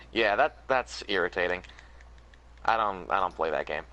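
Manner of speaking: ordinary talk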